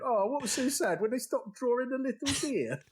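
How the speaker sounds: high-pitched sarcastic sympathy; mocking. Joey teasing Chandler about Bambi, mocking. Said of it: "plagiarising a very good friend's joke".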